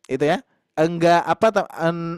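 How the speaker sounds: ordinary talk, nothing marked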